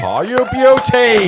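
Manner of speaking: loud, drawn-out "you beauty" whoop; excited cheer. Real, not acted. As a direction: belt it out like a big Aussie cheer. Delighted cheer at a mate's toast.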